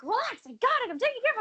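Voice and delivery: high, rushed mock panic; panicked retelling. Retelling the flood to Naeem, high mock panic. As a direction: squeak it out in alarm, fast. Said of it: "Naeem was like".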